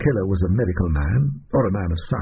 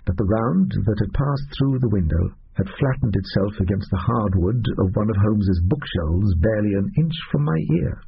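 plain reading voice

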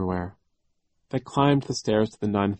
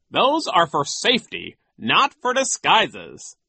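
high, loud, slowing correction; pompous official setting children straight; Nero; 9 semitones higher, 6 dB louder, slower than usual. A narrator's reading. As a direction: dismiss it, stretching the last words. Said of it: "Nero said".

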